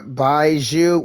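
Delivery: ordinary talk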